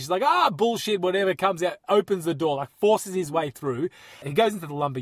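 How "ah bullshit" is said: higher-pitched mock-irritated swearing; retold swearing. Donnie swearing at the lumber yard men, mock-irritated. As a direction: imitate an irritated man cursing someone off. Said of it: "swearing at them".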